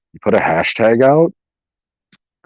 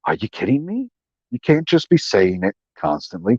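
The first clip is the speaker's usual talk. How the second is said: ordinary talk, nothing marked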